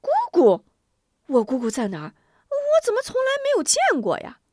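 put-on high, loud surprised child voice; boy asking his mother about an aunt, puzzled. A narrator's reading. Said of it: "吴忠更加奇怪".

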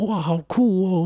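high excited exclamation; impressed. Retold or imitated. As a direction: gush like it's the coolest thing. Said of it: "然后我就说".

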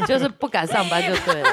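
words spoken through laughter; laughing. Real, not acted. Teasing the coworker who skipped work, said laughing. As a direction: joke while still laughing.

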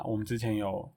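ordinary talk

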